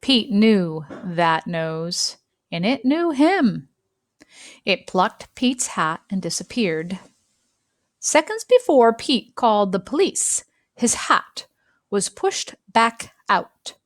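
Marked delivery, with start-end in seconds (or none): none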